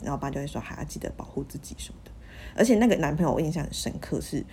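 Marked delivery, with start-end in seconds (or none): 0.6-2.1 whispered reminder; dad's hushed advice. Retold or imitated. Dad quietly telling his daughter to use condoms, whispered. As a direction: whisper it like a discreet father.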